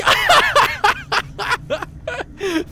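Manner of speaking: long, high burst of laughter; real giddy laughter; high delighted laugh. Real, not acted. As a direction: let the laugh ring out in glee. Laughing after the shout.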